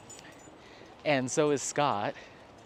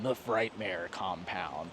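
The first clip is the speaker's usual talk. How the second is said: low, slow spooky-ad announcer; radio ad; 5 semitones lower, 5 dB quieter, slower than usual. Retold or imitated. Recalling haunted house ads from childhood, low slow announcer. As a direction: imitate a Halloween radio spot. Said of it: "I've always heard the radio ads for it".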